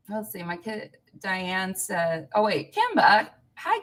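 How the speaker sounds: normal talking voice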